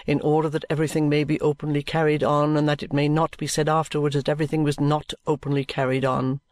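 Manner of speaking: low, measured, solemn lawyer voice; lawyer Vholes. A narrator's reading. Lawyer Vholes assures the guardian, low and solemn.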